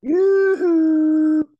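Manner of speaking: high, loud cheerful whoop; excited whoop. Real, not acted. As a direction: whoop it up with delight. Cheering at dip invite.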